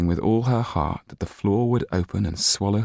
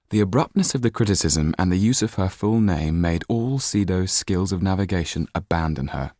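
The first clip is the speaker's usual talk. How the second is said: usual speaking voice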